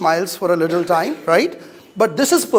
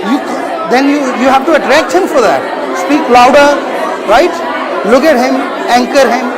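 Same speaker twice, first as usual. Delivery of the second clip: loud, forceful calls to speak up; shouted coaching; 3 semitones higher, 3 dB louder, much faster than usual. Real, not acted. Teacher pushing student to project, loud shouting. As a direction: shout across the hall to make her louder.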